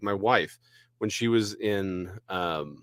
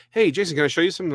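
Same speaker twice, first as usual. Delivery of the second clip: friend's eager question; 8 semitones higher, 4 dB louder, much faster than usual. Retold or imitated. Friend voiced in spider story.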